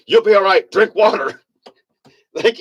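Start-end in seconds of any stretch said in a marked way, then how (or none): 0.1-1.4 imitating sergeant. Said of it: "the sergeant said".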